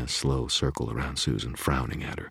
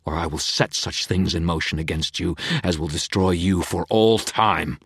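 vicious growl; 2 semitones higher, 4 dB louder than usual. A narrator's reading. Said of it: "vicious growl".

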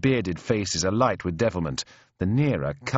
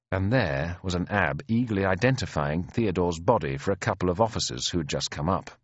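ordinary delivery

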